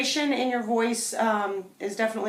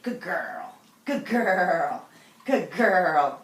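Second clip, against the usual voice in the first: excited praise; much slower than usual. Real, not acted. Praising dog at play.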